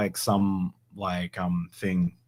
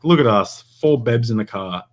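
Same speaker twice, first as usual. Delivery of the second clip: louder, sing-song hint; playful hint; 3 semitones higher, 9 dB louder than usual. Retold or imitated. In the car with friends, cheeky loud hint. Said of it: "I was like".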